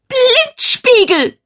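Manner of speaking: very high, slow drawn-out shriek; high, stretched angry scream; furious shriek. A narrator's reading. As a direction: scream the insult at the mirror. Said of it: "schrie Jennifer wütend".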